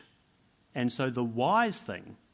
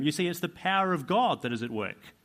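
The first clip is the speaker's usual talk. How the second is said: usual speaking voice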